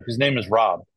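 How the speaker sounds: ordinary talk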